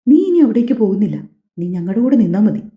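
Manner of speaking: plain reading voice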